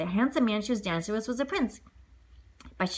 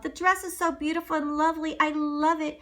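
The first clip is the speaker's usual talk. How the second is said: put-on grateful girl; 6 semitones higher than usual. Cinderella thanking the animals.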